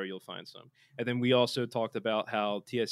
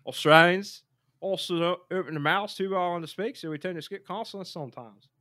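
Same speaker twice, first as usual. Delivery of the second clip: put-on Australian accent, pitched higher; 7 semitones higher, 7 dB louder than usual. Host reading accent tips to co-host, Australian accent. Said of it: "this is some accent advice from me".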